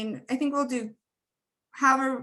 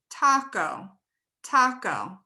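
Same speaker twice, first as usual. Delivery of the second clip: "taco" with the first syllable TA stressed and the "o" vowel long and open; slower than usual. Shown as a demonstration. Teacher to class, modeling "taco" with stress on TA. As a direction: stretch "taco" as TAH-ko. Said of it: "Where's the stress?".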